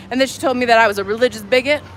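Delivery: ordinary talk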